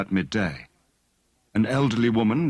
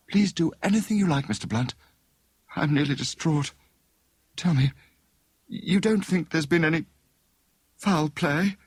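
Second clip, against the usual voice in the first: put-on higher, anxious young lady pleading; a touch of nervous worry, pitched up; lightly voiced distraught young woman; 8 semitones higher than usual. A narrator's reading. Narrator voices Mary Chilcot.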